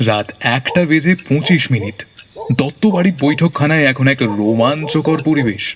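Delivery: ordinary delivery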